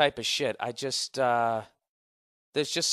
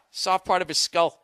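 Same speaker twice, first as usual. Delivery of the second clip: ordinary delivery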